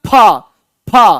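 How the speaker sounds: फा started with both lips meeting, aspirated P; both-lips PH sound. Shown as a demonstration. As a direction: pop फा from closed lips, separately. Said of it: "दोनों होठ से मिलता है". (फा, दोनों होंठ मिलाकर)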